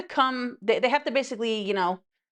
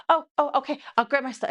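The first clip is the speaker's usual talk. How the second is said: put-on higher-pitched, eager girly voice; pretend girlfriend; 6 semitones higher, faster than usual. Retelling a TikTok girlfriend rushing to help with groceries, higher-pitched girly imitation. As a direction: imitate a man playing an obliging girlfriend. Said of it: "it's him pretending to be a girl".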